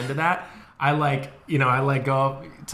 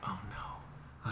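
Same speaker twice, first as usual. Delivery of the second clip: hushed dread; 5 semitones lower, 20 dB quieter than usual. Retold or imitated. Retelling panicked high moment.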